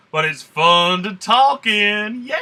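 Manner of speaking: slow, loud, high hammed-up Southern drawl; fake Southern drawl. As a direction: stretch it out in a put-on Southern accent. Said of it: "this southern drawl is totally fake".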